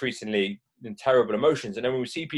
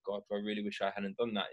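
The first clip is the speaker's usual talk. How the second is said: embarrassed mutter; 3 semitones lower, 10 dB quieter than usual. Shown as a demonstration. Voicing embarrassment after road rage.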